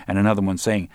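plain reading voice

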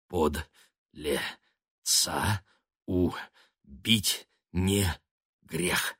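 very slow, each syllable spaced apart. A narrator's reading. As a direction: count out each syllable like footsteps. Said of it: "каждый слог отмечал шаги Субедара".

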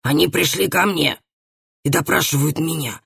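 firm, mildly stern rebuke. A narrator's reading. Father rebukes his daughter for interrupting, stern. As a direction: put her in her place sternly, without raising the voice. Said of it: "сказал ей отец строгим тоном".

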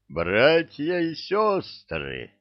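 slow, high-pitched proclamation; exclaiming. A narrator's reading. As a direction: cry out to a congregation, stretching the words. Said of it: "воскликнул он".

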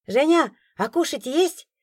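put-on slow, high, hopeful child asking; a little boy hoping for food. A narrator's reading. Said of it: "спросил он с надеждой".

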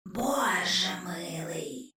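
slow, breathless sneering whisper; a ghost whispering scorn inside her head; mocking whisper. A narrator's reading. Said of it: "насмішкуватий шепіт". (повільний глузливий шепіт)

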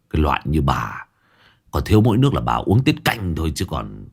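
low, scolding village woman; scornful neighbour. Acted. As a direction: put the greedy woman down, voice dropped low. (bà hàng xóm giọng trầm, mắng mỏ)

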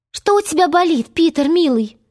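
high, flustered question; alarmed concern. A narrator's reading. Wendy hears Peter groan, alarmed and tender. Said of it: "всполошилась Венди".

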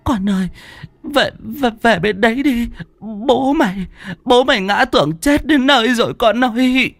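put-on high, tearful old woman's voice; weeping old mother begging her son; old mother pleading. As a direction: beg your son to come home.

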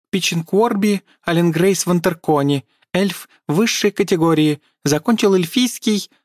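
high, pompous squeaky elf. A narrator's reading. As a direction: announce himself pompously in a squeak. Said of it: "напыщенно начал эльф".

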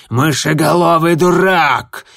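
loud, slow enraged insult; furious yell. Acted. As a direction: roar it in fury. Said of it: "в ярости заорал звездоцап".

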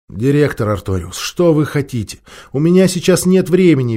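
plain reading voice

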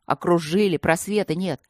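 usual speaking voice

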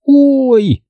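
high yelp of pain; howling ouch. A narrator's reading. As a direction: cry out when it stings. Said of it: "взвыла она".

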